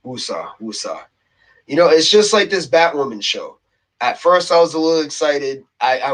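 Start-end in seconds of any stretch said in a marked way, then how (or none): 0.0-1.0 low, soft self-soothing chant; calming himself down. Real, not acted. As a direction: exhale it low and hushed to settle yourself. Calming himself after getting worked up.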